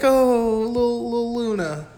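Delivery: put-on high baby-talk for a pet; cooing at pet. Podcaster fussing over a pet, mock cooing.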